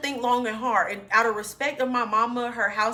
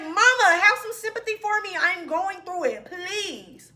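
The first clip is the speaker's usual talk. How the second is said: whiny plea; 8 semitones higher than usual. Retold or imitated. Daughter begging her mama for sympathy, high whiny plea. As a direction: beg like a sulky kid.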